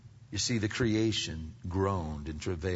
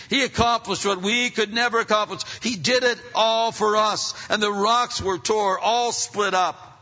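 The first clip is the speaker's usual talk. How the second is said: high, loud, driving preacher's cry; full-force preaching; 11 semitones higher, 9 dB louder than usual. Acted. Preacher thundering to the congregation about creation shaking.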